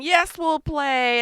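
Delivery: a bird happily agreeing to play; voicing the birds. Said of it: "And the birds are saying".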